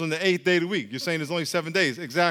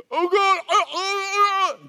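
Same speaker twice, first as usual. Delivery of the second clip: panicked gasping; 13 semitones higher, slower than usual. Acted. A dying man cries out to God in panic. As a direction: gasp out a panicked prayer.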